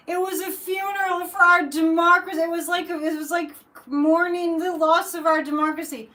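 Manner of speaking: loud, high-pitched mimicked outcry; mock lament. Imitating a social media post about democracy's funeral, loud mock lament. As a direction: wail it loudly, imitating an overwrought commenter.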